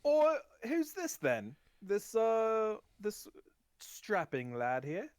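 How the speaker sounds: high, loud gnome exclamation settling down; curious gnome merchant. Acted. Gnome merchant meeting a strapping lad, high and curious. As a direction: make a show of sizing up a newcomer, pitched high. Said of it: "He goes".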